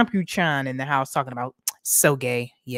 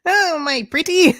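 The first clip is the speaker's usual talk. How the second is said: high, loud wicked-witch screech; witch cackle voice; 12 semitones higher, 8 dB louder than usual. Acted. Streamer greets viewers as a loud wicked witch. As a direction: cackle it like the witch behind the curtain.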